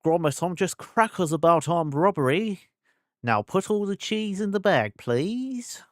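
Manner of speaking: high, put-on Wallace voice, cheerful northern English; a high, chirpy northern English inventor's voice; Wallace impression. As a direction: camp it up as Wallace from Wallace and Gromit. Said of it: "I gotta turn up the Brit".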